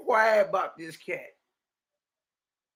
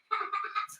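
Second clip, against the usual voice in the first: short laugh; 10 dB quieter than usual. Real, not acted. Laughs at child's remark.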